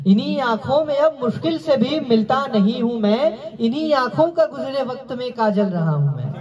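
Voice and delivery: lifted, lilting mushaira verse; poetry recitation. Acted. Recites second ghazal.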